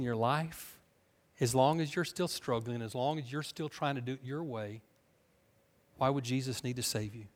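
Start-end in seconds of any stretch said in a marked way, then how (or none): none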